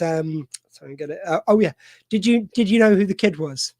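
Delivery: ordinary talk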